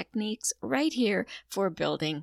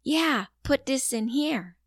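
put-on child voice; 6 semitones higher, 5 dB louder, slower than usual. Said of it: "He says".

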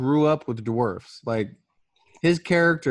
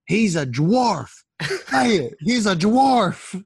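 higher, slow, hammed-up yell; mock scream; 6 semitones higher, 4 dB louder, slower than usual. Furious husband screaming at wife, slow mock yell. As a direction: bellow it slowly like a madman. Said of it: "He's just screaming".

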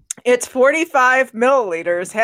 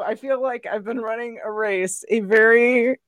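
ordinary delivery

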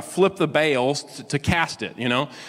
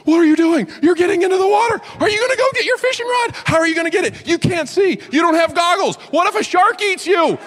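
put-on much higher, louder shrill kid questions; hammed-up pestering little boy; 5 dB louder than usual. Kid peppering him as he enters water, high loud kid voice. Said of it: "this little boy's going".

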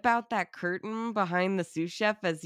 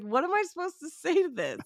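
much higher baffled question; lost for words, pitched way up; bewildered; 11 semitones higher than usual. Retold or imitated. Viewer reacting to the killer's confession, high baffled question.

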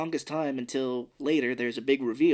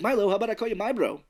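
higher, fast, eager pitch; lightly voiced chatty Gribble; 8 semitones higher, 4 dB louder, faster than usual. Gribble nicknaming Milo, a hint of fast eager chatter. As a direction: rattle it off like an overeager new buddy. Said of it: "He's like".

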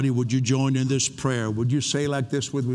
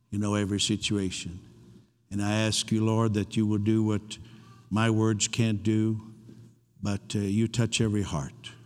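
prayer; 3 semitones lower than usual. Real, not acted. Leads the room in prayer.

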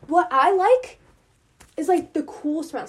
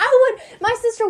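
laughing; 8 semitones higher, 4 dB louder than usual. Real, not acted. Laughing while telling story.

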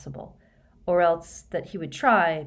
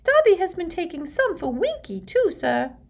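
high, loud house-elf squeak; cheerful high-pitched elf voice; happy squeaky elf; 13 semitones higher, 6 dB louder than usual. A narrator's reading. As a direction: chirp it happily as an elf. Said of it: "said Dobby happily".

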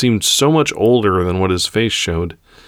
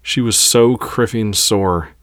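ordinary delivery

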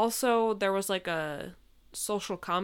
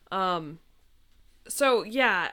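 usual speaking voice

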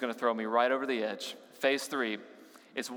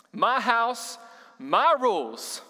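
louder, slow parent's rule; stern parent; 7 semitones higher, 5 dB louder, much slower than usual. Retold or imitated. Pastor imitating parents telling off a child, mock stern. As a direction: lay down the law like a parent. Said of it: "This is the phrase".

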